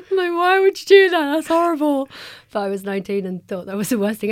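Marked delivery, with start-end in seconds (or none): 0.1-2.1 high, loud mock outrage; appalled reaction. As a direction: act out being horrified at the advice. Voices own appalled teenage reaction.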